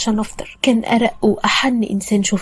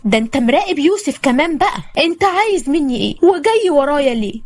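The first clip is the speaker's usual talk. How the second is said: higher-pitched, acted challenge; indignant confrontation; 5 semitones higher, 4 dB louder than usual. A narrator's reading. Woman confronts her stalking ex.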